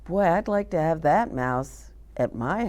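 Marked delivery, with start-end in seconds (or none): none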